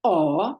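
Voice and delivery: Aw from far back in the throat; throaty Hebrew vowel; guttural aw. Shown as a demonstration. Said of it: "sort of like the ah sound".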